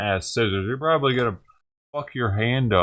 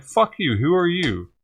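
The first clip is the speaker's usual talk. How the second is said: mock hostile retort; 8 semitones higher than usual.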